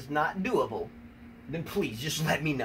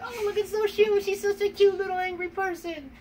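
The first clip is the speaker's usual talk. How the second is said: high, louder cooing squeal; cooing baby talk; 14 semitones higher, 5 dB louder than usual. Retold or imitated. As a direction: coo at her like she's a puppy. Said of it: "And I'm like".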